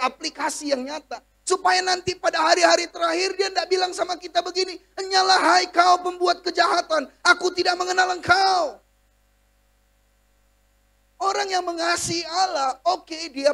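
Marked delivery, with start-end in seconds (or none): 5.0-8.8 loud, high and commanding; stern divine rejection. Acted. The king on the last day drives evildoers away, shouting. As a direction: cast them out with a shout.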